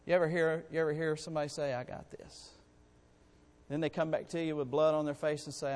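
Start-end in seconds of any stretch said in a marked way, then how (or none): 1.7-2.5 whispered, much softer boast; mock cocky whisper. Preacher imitating an overconfident believer in a whisper. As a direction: hush the brag like a sly aside.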